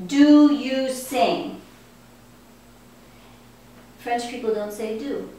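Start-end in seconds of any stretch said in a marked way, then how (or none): 0.1-1.6 very slow, louder example question; slow teacher model. Shown as a demonstration. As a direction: stretch each word out for the class.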